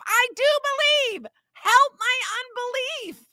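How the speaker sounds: high, strained cry of a father at his limit; desperate cry. Acted. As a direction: cry it out, pitched high, at the end of his rope. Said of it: "this father had had enough".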